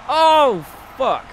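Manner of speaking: high, loud startled "Oh"; shocked gasp. Real, not acted. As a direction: gasp it out at the impact. Reacting to a big hit.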